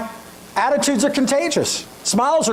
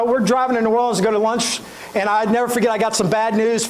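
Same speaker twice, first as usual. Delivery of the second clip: ordinary delivery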